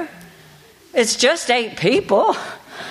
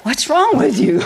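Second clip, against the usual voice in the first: lightly acted boss, higher pitch; overseer's question; 5 semitones higher than usual. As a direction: mimic a concerned demand, pitched up. Imitates overseer questioning her on the phone.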